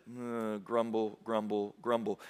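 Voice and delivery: low, soft grumbling crowd; preacher voicing a grumbling crowd, low and sulky; mock grumble. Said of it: "They grumble".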